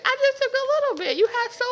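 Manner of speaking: fast high excuse. Retold or imitated. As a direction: blurt it out fast like a caught thief. Acted thief excuse.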